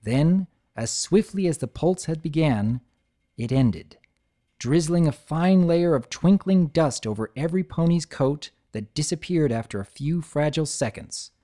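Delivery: plain reading voice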